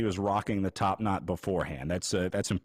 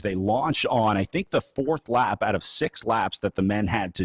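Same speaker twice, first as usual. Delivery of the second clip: race commentary; 5 dB louder than usual. Acted. Commentator drives race recap.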